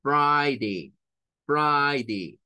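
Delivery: Friday clearly as FRAI-day; Friday with an I sound. Shown as a demonstration. Teacher repeating Friday for the class. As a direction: say Friday, vowel like I. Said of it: "suena a I".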